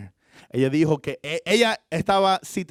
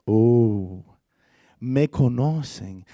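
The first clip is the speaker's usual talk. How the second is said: slow emphasis; 4 semitones lower, much slower than usual. Real, not acted.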